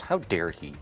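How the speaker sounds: ordinary talk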